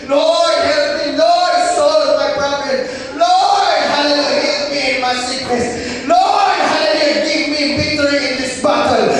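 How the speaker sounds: loud, high cry to the Lord; pleading prayer. Retold or imitated. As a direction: beg the Lord, pleading aloud. (nag-ampo nga nagpakiluoy)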